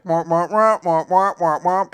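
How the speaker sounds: high, loud womping; punchy run of cartoon womps; droning womp-womp mimicry. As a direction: imitate talk turning into meaningless noise. Mimics talk he isn't hearing.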